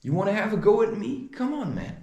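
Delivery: high, fast mock fighting call; retold challenge. Daring the fat guy to fight, high retold challenge. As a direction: throw down the challenge, pitch climbing. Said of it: "I said".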